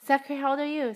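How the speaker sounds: usual speaking voice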